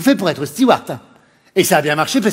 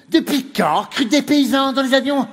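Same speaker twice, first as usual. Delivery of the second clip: snooty passenger; 7 semitones higher than usual. Retold or imitated. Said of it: "des réflexions, c'est".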